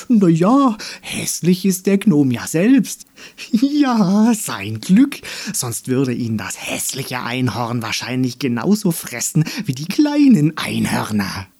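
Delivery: high, loud flower voice trading insults; spiteful flowers egging each other on; jeering flower. Acted. (hämische Blumen, hoch und laut)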